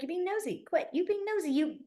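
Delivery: high, light teasing; high playful tease. Retold or imitated. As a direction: playfully call them out. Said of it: "I'll always be like".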